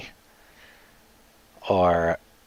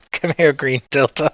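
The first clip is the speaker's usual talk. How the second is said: calling the cat; 7 semitones higher than usual. Pretend.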